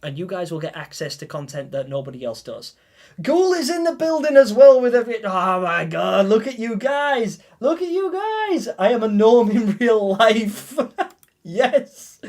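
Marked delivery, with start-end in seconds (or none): 3.2-12.2 high, loud, slow and giddy; real delighted squeals to the chat; overwhelmed excitement. Real, not acted. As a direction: gush with disbelieving joy. Overwhelmed streamer reacts to gifted memberships.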